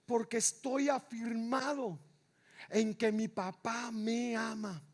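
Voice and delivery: ordinary talk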